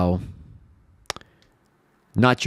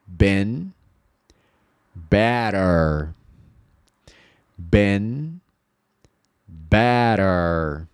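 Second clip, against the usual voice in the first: Been and better split by long pauses; spaced out teacher drill; slow repeat-after-me drill; much slower than usual. Shown as a demonstration. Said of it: "speak aloud".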